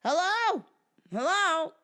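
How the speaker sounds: high, loud phone hello; the wife on the phone. Retold or imitated. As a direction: answer the phone as the shrill widow. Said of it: "Wait till you hear this call".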